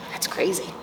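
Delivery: hushed conspiratorial whisper; whispered aside. Acted. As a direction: lean in and whisper it.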